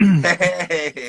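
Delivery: long, drawn-out delighted hey; excited greeting. Real, not acted. Greeting a friend joining in.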